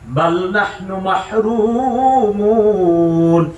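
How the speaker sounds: drawn-out Quranic chanting; slow recitation. Acted. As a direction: intone it slowly like recitation. Recitation of verse.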